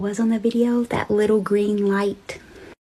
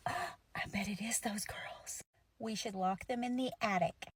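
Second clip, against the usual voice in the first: whispering nun; 21 dB quieter than usual. Retold or imitated. Nun whispering a plan to lock the girls up. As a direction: hush it like a plotting nun.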